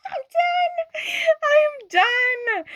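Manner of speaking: very high squealing laugh; overcome, squeaking out the words; laughing falsetto I'm done; giddy overwhelm. Real, not acted. Overwhelmed by card meaning.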